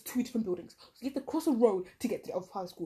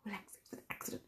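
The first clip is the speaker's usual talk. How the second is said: soft, breathless hushing; quiet her down without being heard; hushed plea; 15 dB quieter than usual. Retold or imitated. Hushing classmate in assembly.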